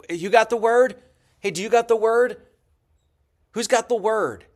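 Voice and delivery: hammed-up Mason hunting for the word; mock searching Mason. Lecturer mocks Masons searching for the word, hammed-up calls. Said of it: "They're like".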